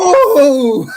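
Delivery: loud, high drawn-out "ooh"; real whoop at a big play; excited ooh. Real, not acted. Host watching the dunk replay, excited ooh.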